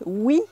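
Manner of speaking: crisp "Oui" with an ee vowel; proper yes. Shown as a demonstration. French teacher contrasts three yeses, the standard one. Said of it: "You can hear a difference?".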